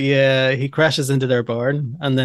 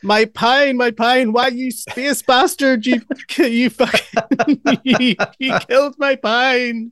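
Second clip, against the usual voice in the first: much higher, slow farmer wail, laughing through it; 9 semitones higher, slower than usual. Retold or imitated. Farmer lamenting his pine tree, high laughing wail. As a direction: mourn the pine as the outraged farmer, cracking up. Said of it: "He said".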